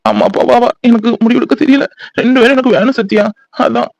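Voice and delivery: usual speaking voice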